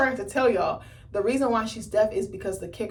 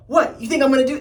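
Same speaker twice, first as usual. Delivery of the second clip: mock threatening quote; 5 semitones higher, 9 dB louder than usual. Voicing Emma waving a knife.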